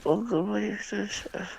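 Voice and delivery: high, bright show-host greeting; high intro voice. Acted. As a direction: sing out the welcome like an announcer.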